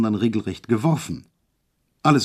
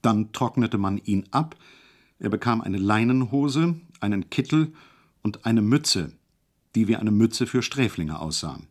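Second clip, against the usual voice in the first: ordinary delivery, nothing marked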